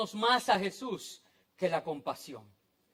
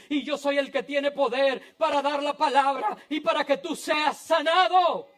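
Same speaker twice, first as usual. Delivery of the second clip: building proclamation; 4 semitones higher, 4 dB louder than usual. Acted. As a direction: declare healing with growing authority. Jesus' words built to a climax.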